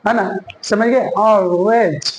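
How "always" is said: Always drawn out as "aw-wez", L hidden; slow Always, rounded first vowel, falling at the end; dark L demo. Shown as a demonstration. Teacher models it for learners, dark L demo. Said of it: "कैसे बोलेंगे".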